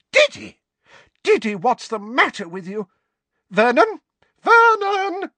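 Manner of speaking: high, loud frantic mother's cry; shrill panicked aunt. Acted. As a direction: shriek for help, panicking. Petunia shrieking for Vernon.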